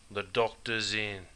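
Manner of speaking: slow, soft, lazy "The doctor's in"; slow drawl. Retold or imitated. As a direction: imitate a laid-back local, very slowly. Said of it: "slowly say".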